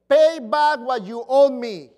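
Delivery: loud harsh demand for payment. Acted. Servant choking his fellow servant, loud demand. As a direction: bark the demand at the debtor. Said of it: "he demanded".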